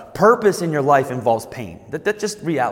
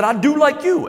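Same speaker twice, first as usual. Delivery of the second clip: put-on much higher little-boy voice; cute kid voice, pitched way up; cute five-year-old; 11 semitones higher than usual. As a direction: imitate a small child explaining himself. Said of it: "in a cute little five-year-old voice".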